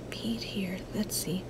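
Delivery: soft whisper under her breath; whispering to herself. Real, not acted. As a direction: whisper it to yourself while you work. Muttering to herself while crafting.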